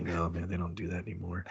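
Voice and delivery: understated muttering; mock mutter. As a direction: grumble it to yourself. Doctor mutters on roof.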